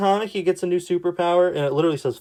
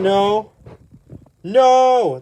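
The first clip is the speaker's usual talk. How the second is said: mock cry; 6 semitones higher, 7 dB louder than usual. Reading doomed hero's cry.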